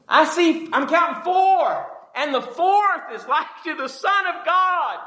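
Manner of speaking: high, ringing cry of amazement; astonished king. Acted. King seeing a fourth man in the furnace, astonished high cry. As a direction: cry out in high-pitched disbelief. Acts out the astonished king's discovery.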